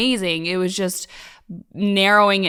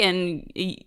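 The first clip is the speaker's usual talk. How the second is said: normal talking voice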